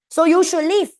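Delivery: a playful mock scolding for the class; mock angry order. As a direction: bark it out as the annoyed Belanda. Teacher voices grumpy Belanda.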